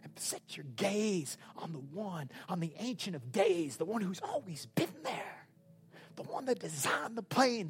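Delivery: intense, soft preaching; hushed exhortation. Real, not acted. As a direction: drop your volume and urge them tenderly, sinking almost to a whisper.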